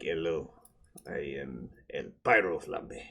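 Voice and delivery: low, soft mock foreign accent; hushed introduction in a bad foreign accent; El Pyro Flambe. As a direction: play the foreign spy contact, quiet and low. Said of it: "meet your foreign contact".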